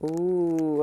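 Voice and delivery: ordinary talk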